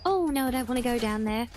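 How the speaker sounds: ordinary talk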